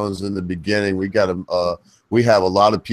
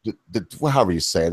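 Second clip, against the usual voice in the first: usual speaking voice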